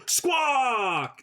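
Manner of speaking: pushing out a high squawk shout. Pretend. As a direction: blurt it out as a big yell. Forcing out a squawk bit.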